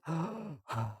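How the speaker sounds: high gasp after a long run-on; breathless gasp. Real, not acted. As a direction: suck in air at last. Breathless at end of long read.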